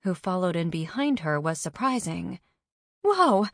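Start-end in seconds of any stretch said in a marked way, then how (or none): 3.0-3.5 high, loud whoa; startled exclamation, pitched way up; gasped surprise. A narrator's reading. Carney sees who followed Alice in, gasping in surprise. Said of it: "Carney gasped".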